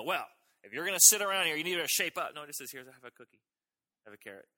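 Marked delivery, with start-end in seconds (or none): 0.0-2.3 higher, louder scolding mother; hammed-up nagging mom; the mother the story rejects, scolding; mock stern parent. 2.7-4.5 tender mother. Acted. Gentle mother offering carrot.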